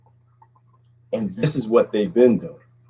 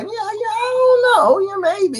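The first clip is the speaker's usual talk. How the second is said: slow, high-pitched hemming and hawing; drawn-out comic doubt, pitched way up; mock doubtful. Host sizing up this dude, mock doubtful.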